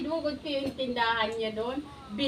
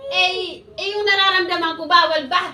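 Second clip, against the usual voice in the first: loud, higher indignant challenge; heated retort; 5 semitones higher, 9 dB louder than usual. Retold or imitated. Wife snapping back at the guard who forbids a scene, loud. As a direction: throw the question back, rising in anger.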